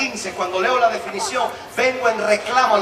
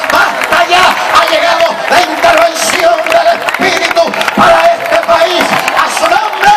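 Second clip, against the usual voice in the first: loud, much higher rebuking cries; fiery preaching; 8 semitones higher, 10 dB louder, slower than usual. Acted. Preacher commands the devil to stop over the congregation, shouting. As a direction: rebuke the devil with full force.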